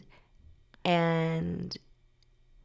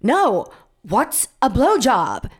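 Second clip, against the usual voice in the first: slow, louder, higher demand; loud insistence; 4 semitones higher, 6 dB louder, slower than usual. Retold or imitated. Girl demands answers from shushing friends, loudly insistent. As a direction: refuse to be shushed.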